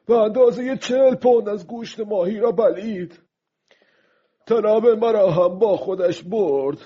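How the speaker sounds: high-pitched, agitated lament aloud; speaking aloud, loudly. Acted. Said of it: "با صدای بلند گفت". (با صدای بلند و زیر، آشفته)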